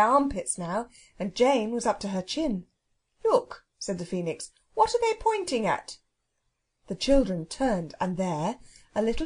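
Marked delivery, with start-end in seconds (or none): 3.2-3.7 high, sudden call to look; urgent alert. Acted. Phoenix alerts fleeing children. 4.7-6.0 high-pitched, excited query; urgent question. Acted. Phoenix alerts fleeing children.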